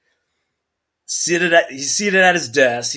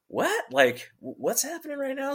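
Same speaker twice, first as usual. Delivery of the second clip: bewildered disbelief; 13 semitones higher, 6 dB quieter than usual. Retold or imitated. Baffled by lieutenant's story.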